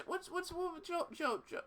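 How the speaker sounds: ordinary talk, nothing marked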